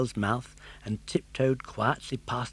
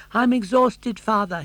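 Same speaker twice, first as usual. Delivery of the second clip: panting; 9 semitones higher, 8 dB louder than usual. Acted. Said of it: "he panted".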